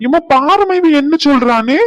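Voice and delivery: high, loud outraged whine; loud tattling complaint. Acted. Sibling complaining to mother about the other, loud protest. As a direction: shout for mother to step in.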